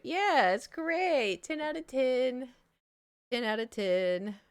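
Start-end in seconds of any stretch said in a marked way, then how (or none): 0.0-2.5 drawn-out, higher mock praise; slow, sing-song fake enthusiasm; sarcastic. Rating the gross trip to chat, mock-cheerful and slow.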